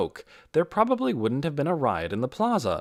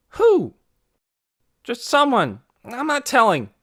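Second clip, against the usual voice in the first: heated exchange; 13 semitones higher, 9 dB louder than usual. A narrator's reading.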